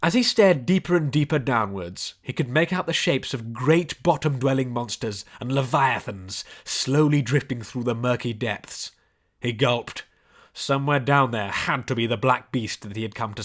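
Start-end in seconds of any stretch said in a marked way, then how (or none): none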